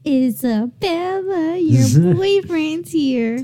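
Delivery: high, slow, called-out line; emphatic song line. Retold or imitated. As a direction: call it out like the song. Quoting song at the end.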